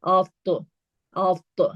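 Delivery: heavy bouncing T in افت; افت as a thick "awt-to"; heavy qalqala. Shown as a demonstration. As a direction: lift the tongue for a heavy AT and bounce it. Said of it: "اور تو".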